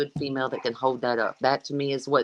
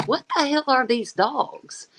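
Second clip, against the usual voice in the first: amazed question; 9 semitones higher, slower than usual. Retold or imitated. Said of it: "And I'm like".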